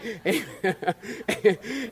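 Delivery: real laugh at his own joke; preacher's laugh. Real, not acted. Preacher laughing at his joke to the congregation. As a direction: let a laugh escape.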